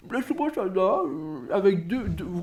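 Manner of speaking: high-pitched celebrity impression; exaggerated mimicry, pitched well up; Pierre Bénichou. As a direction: put on a high, hammed-up old raconteur's voice. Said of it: "Pierre Bénichou connaît la sobressada".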